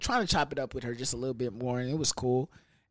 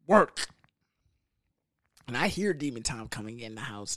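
ordinary talk

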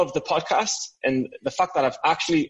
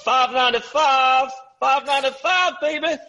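hammed-up high catchphrase; put-on catchphrase voice; 10 semitones higher than usual. Putting on a catchphrase voice.

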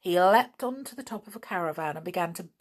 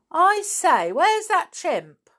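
calling out; 11 semitones higher, 7 dB louder, slower than usual. Acted. Nobby calls out looking around.

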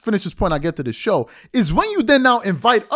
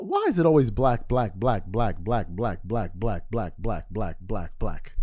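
low, monotone rapid-fire chant of one word; mocking repetition; 10 semitones lower, 6 dB quieter, slower than usual. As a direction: drone it on like a broken record. Mimics endless repetitive commentary.

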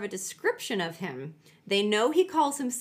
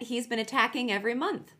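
ordinary talk, nothing marked

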